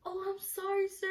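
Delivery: high, squeaky retold apology; mock-sheepish and high-pitched; apologetic. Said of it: "I was like".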